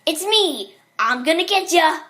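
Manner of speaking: high, loud character yell; big theatrical shout; Foxy. Acted. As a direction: yell it like a mascot starting a chase. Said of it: "He yelled".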